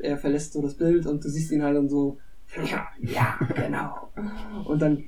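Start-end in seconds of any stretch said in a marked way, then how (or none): 2.7-4.1 whispered, sly and pleased; gloating whisper. Retold or imitated. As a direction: Cartman gloats secretly to himself, whispered. Cartman gloating to himself. (geflüstert, hämisch zufrieden)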